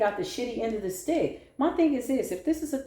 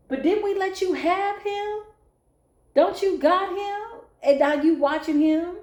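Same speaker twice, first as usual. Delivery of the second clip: high, taunting questions; mocking taunt; 9 semitones higher, 4 dB louder than usual. Real, not acted. Reader taunts the ex's new partner, high and mocking. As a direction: needle her with high mock disbelief.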